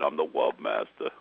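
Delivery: poking fun at a bad love voice, cartoon style; Elmer Fudd. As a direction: tease him with a cartoon lisp, dropping the pitch. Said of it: "like you're Elmer Fudd".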